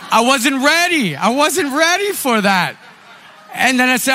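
high, laughing disbelief. Real, not acted. Laughing to the church about being unprepared. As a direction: laugh it off, still caught out. Laughing at his own story.

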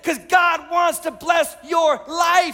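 high, loud pulpit exhortation; impassioned preaching. Real, not acted. Preacher urging congregation to go after God, impassioned preaching. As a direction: drive each phrase home, stretching key words. Climax of the sermon.

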